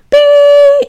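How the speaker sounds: high, loud sustained beep; tinnitus ringing. Retold or imitated. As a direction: imitate a piercing ring in the ear. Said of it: "Um, tinnitus".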